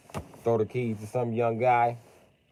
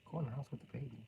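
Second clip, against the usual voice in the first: menacing caller; 16 dB quieter, faster than usual. Acted. Caller softly threatening the wife on the phone.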